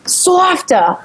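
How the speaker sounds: imitated Long Island accent, pitched high. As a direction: echo the word in mock Long Island accent. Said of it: "the accents are just so different".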